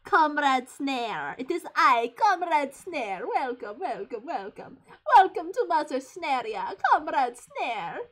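slow, hammed-up Russian comrade; bad Russian accent. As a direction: greet them grandly in mock Russian. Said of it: "I can't do a Russian accent very well".

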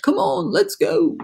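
high, keen urging; excited storybook crowd; eager chorus. A narrator's reading. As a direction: hurry them along in a high voice. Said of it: "they chorused".

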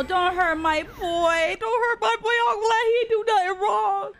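high, loud wailing plea; distressed pleading. Real, not acted. Pleading as favourite character is attacked.

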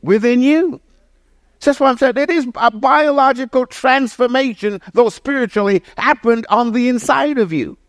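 climbing pulpit fervor; full-force preaching. Acted. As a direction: lift the room, preach it out.

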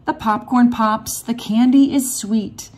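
plain reading voice

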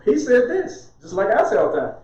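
normal talking voice

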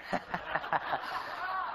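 breathy nervous chuckle; mock nervous laugh. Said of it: "Little nervous laughter".

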